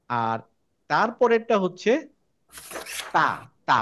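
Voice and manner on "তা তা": তা with the mouth open, air flowing out. Shown as a demonstration. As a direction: let the air out on তা. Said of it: "মুখটা খুলে ফেলবো".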